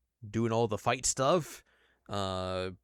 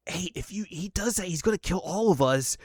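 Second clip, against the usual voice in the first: pleading Bulma; 8 semitones higher, 3 dB louder than usual. Retold or imitated. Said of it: "Bulma's just like".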